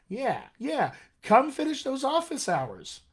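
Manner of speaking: eager, high-pitched coaxing; excited. Real, not acted. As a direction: cheer them on, voice lifted with excitement. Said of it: "I'm excited now".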